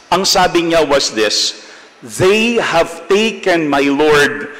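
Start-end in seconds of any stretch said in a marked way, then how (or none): 2.0-4.5 "They have taken my Lord" slow, leaning on "my Lord"; slow down and weigh "my Lord"; stress on my Lord. Shown as a demonstration. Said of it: "Yung kanyang ginamit na title for Jesus was". (diin sa "my Lord")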